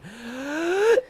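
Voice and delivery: high rising comic gasp; a squeaky theatrical gasp; mock gasp. Hosts signing off, with a high mock gasp. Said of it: "both of us went for a high pitch there".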